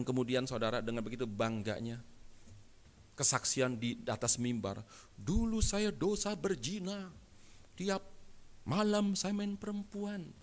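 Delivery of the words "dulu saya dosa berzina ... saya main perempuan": high, drawn-out proud confession; mock boastful testimony. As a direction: imitate someone showing off past sins. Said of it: "dengan bangganya".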